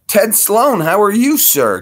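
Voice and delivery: big, high, loud hello; hearty greeting. Real, not acted. Greeting viewer Ted Sloan with a big, high, loud hello. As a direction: boom out a welcome to a friend.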